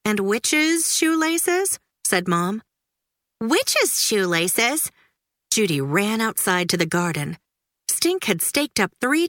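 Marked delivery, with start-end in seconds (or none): 0.1-1.8 high, slow, puzzled question rising; a puzzled mother; Mom wondering. A narrator's reading. 3.4-4.9 slow, drawn-out disbelieving repeat; astonished kid repeating it; incredulous echo. A narrator's reading. Judy echoes in disbelief.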